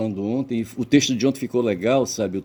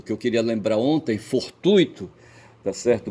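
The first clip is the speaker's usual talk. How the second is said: ordinary talk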